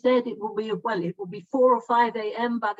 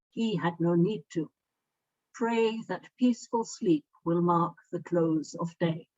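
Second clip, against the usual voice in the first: poem reading; 5 semitones lower than usual. Acted. Poet reading her poem to the online gathering, measured recital. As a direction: read the verse slowly and with weight.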